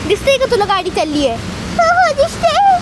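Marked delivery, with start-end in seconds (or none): 1.8-2.8 put-on high, slow child's reply. To her mum about the van, a high child voice. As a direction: answer like a little girl. [name] answers.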